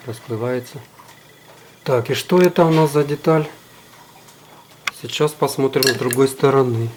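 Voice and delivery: ordinary talk, nothing marked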